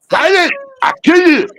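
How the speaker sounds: high shrieking puppet threat; Achmed the Dead Terrorist. Retold or imitated. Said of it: "you know Def Dunham's Ahmed".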